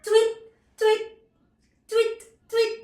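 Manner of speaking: high, loud imitated chirps; teacher's high-pitched bird call; bird tweet. Shown as a demonstration. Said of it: "And the bird says".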